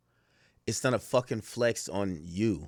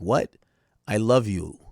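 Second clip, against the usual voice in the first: ordinary talk, nothing marked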